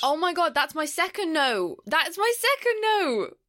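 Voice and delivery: real excitement. Real, not acted. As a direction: squeal with delight at the coincidence. Delighted at sharing the same note.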